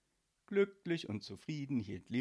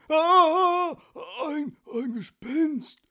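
shrieking high and loud, then a breathless whispered stammer; shriek, then stammer in panic; terrified scream; 15 dB louder, much slower than usual. Acted. Postman face to face with a ghost, screaming in terror. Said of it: "schrie dieser".

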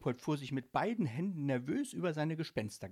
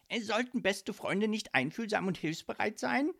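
high-pitched, moderately raised yell; yelling at someone; 10 semitones higher, 4 dB louder than usual. A narrator's reading. Ghost reproaching his best friend, yelling. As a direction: snap at him in a high voice. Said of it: "schrie er Micky an".

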